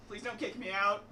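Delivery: ordinary talk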